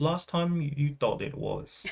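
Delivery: softly mimicking a British accent; hushed British imitation; mock British accent. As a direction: parrot it back in British. Echoes her in mock British accent.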